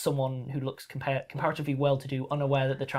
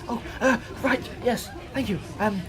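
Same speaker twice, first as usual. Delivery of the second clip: high, flustered gratitude; fussy tailor voice; 8 semitones higher than usual. Acted. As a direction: thank them in a high dither. Fey tailor thanking.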